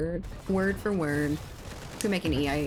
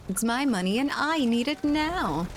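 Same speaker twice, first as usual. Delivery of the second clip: ad jingle quote; 7 semitones higher than usual. Retold or imitated. Streamer quoting the J G Wentworth commercial, mock ad voice. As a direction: belt it like the old TV ad.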